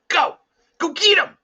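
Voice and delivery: put-on boy shouting his dog on to the deer; urgent shout. A narrator's reading. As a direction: yell to release the dog to attack. Boy sends dog to attack.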